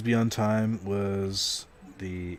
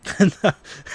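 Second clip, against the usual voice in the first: loud laugh; 11 semitones higher, 8 dB louder than usual. Real, not acted. Laughing at chat removing likes, loud burst.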